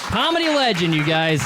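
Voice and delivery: club host announcer. Acted. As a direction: whip up applause for the act just leaving. Plays host after Chase's set.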